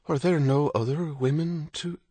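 high, distressed question left unfinished; plead, then break off mid-sentence; faltering plea. A narrator's reading. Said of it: "She couldn't complete it".

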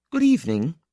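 high-pitched woman's good evening; parting greeting, pitched well up. A narrator's reading. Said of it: "she said at the turning".

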